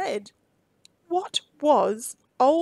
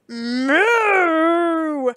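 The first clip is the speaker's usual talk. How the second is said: loud bellowing cow moo; Belinda's big bellow; 4 semitones higher, 7 dB louder than usual. A narrator's reading. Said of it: "bellowed Belinda".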